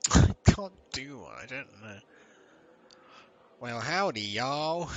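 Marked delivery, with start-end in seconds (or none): 3.6-4.9 loud, hammed-up Texas drawl rising on y'all; Texas accent. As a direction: greet them as a mock Texan, loud and drawn out. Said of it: "a Texas impression".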